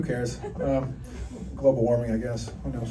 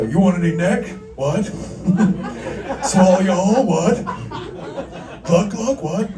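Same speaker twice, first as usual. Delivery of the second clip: loud, higher rasping wrestler promo; Stone Cold impression; 6 semitones higher, 4 dB louder, slower than usual. Ghost guy taunting the comic, as a loud wrestler promo. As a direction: bark it like a wrestling heel cutting a promo.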